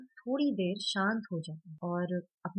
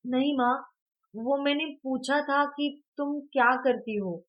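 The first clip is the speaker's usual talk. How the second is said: explaining; 6 semitones higher, 5 dB louder than usual. A narrator's reading. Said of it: "समझाते हुए बोलता है".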